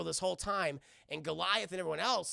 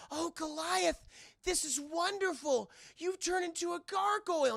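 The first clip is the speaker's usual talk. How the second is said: high, delighted woman's imitation; Elisa Maza; 10 semitones higher than usual. Elisa greeting gargoyle Goliath, acted delight. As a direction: gush in a pitched-up voice. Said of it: "Elisa Maza, she's like".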